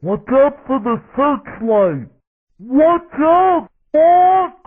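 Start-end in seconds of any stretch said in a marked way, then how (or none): 2.6-4.5 high-pitched shout to a teammate; urgent warning. Acted. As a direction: call out a sharp danger warning. Warns teammate of danger.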